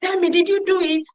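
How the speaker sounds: retold father's angry questioning; harsh interrogation. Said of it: "I was hearing a voice".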